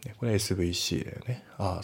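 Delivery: normal talking voice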